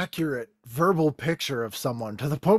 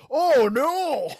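mock alarm; 9 semitones higher than usual. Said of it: "and you'd be like".

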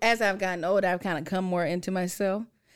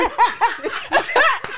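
burst of laughter; 12 semitones higher, 4 dB louder than usual. Real, not acted. Laughing at no shade remark.

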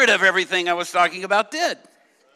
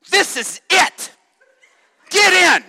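high, loud, emphatic prophetic shout; shouted proclamation; 11 semitones higher, 11 dB louder, slower than usual. Retold or imitated. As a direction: proclaim it at full force to the congregation. Retold prophetic word at the mic.

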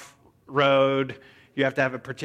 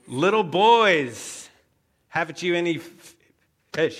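put-on talking down to children; 3 semitones higher, 5 dB louder than usual. Jesus calls to fishermen as children in training, talking down. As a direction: call to them like small children. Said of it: "he's not addressing them as, as peers".

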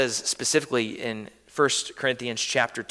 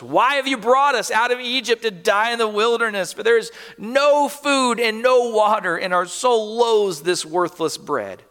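emphasis added; 6 semitones higher, 3 dB louder than usual. Acted. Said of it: "Emphasis added".

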